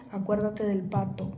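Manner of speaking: low, hushed warning in his ear; sly whisper. Acted. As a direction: lean in and breathe it as a secret threat. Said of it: "le dijo así". (susurro cómplice y amenazante)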